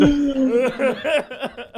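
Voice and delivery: high falling fit of laughter; helpless laugh. Real, not acted. Host still laughing at his co-host's joke. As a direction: give in to the giggles again.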